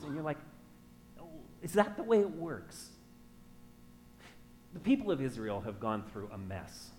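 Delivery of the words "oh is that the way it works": higher-pitched mock disbelief; sceptical questioning. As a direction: act out a pointed, doubting comeback. Voices listener doubting his answer.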